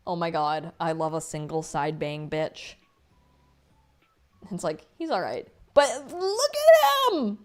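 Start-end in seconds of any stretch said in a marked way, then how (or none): 5.7-7.4 high, loud, drawn-out squeal. Real, not acted. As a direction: gush like a fangirl. Gushing over character art.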